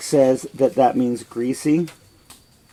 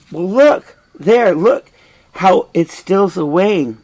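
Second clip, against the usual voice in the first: higher and louder, pointing the queen at the ghost; 5 semitones higher, 5 dB louder, slower than usual. Acted. Hamlet urging his mother to see his father's ghost, loud and high. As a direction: call out, look there!. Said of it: "And Hamlet says".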